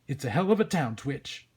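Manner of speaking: loud, wry detective; blustering cop Sam. Acted. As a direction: sum it up like a world-weary New York cop.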